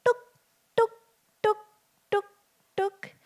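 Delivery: light tapping tuk calls; bird call imitation. Storyteller imitating the barbet's call for the children, light and rhythmic. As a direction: mimic the coppersmith barbet's call. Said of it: "did you hear a voice go".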